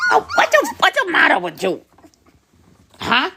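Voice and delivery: high-pitched cooing at a pup. Real, not acted. Breeder asks a fussing puppy what's wrong, high baby talk.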